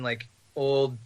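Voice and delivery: ordinary delivery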